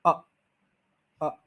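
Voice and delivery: अ as a short, weak schwa; short reduced schwa अ; schwa demo. Shown as a demonstration. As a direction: say अ as a tiny neutral vowel. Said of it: "श्वा sound होता है अ".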